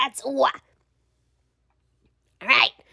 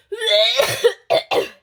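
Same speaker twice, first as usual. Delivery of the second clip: hacking cough; 8 semitones higher than usual. Acted. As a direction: cough hard like a heavy smoker. Smoker's coughing fit.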